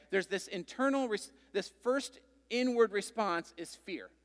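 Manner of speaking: usual speaking voice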